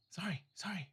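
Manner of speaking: soft breathy apology to the snake; hushed unvoiced sorry; whispered sorry. Real, not acted. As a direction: whisper it as if soothing a pet.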